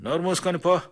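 rough dismissal. A narrator's reading. Foreman throws labourer out.

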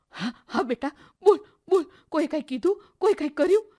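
a mother desperate to know what happened; anxious urging. Acted. Mother urges sobbing daughter to tell, high and anxious.

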